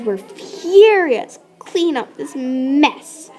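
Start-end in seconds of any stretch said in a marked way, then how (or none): none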